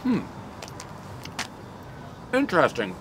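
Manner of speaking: chewing while talking; talking between bites; mouth full of pizza. Real, not acted. Seen 0: Bit slice just before; jaw working, mouth busy during "Hmm, interesting.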